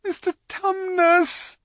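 high, loud, drawn-out yell; bawling. A narrator's reading. Lucy shaking the crying faun, bawling at him. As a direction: bawl the name into his ear. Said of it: "bawled Lucy in his ear".

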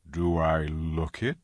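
amused and tender; Aslan's voice. A narrator's reading. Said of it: "he said".

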